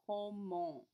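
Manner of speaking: "Comment" ending in a nasal vowel, no final T; French "Comment" with a nasal end. Shown as a demonstration. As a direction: glide "Comment" into a nasal close. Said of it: "comment has a nasal sound at the end".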